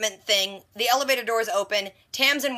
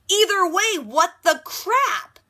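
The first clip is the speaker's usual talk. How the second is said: much higher, louder, very slow; exasperated disbelief; 8 semitones higher, 4 dB louder, slower than usual. Real, not acted. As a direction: drag out what the crap in disbelief. Reacting to the pregnancy twist.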